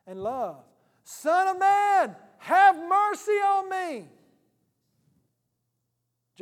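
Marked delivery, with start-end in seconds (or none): 1.0-4.1 much higher, loud, slow, pleading shout; desperate cry out. Retold or imitated. Beggar calling to Jesus in a crowd, pleading shout. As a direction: cry out for mercy across the crowd.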